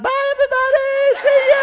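loud, very high singsong falsetto; cheery goodbye. Retold or imitated. Pastor leaves the plane with pink bag, cheery falsetto goodbye. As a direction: call a showy falsetto farewell.

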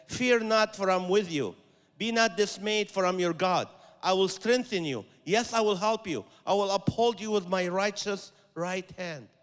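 loud, proclaiming voice of God's promise; forceful scripture reading. Acted. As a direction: declare each promise boldly.

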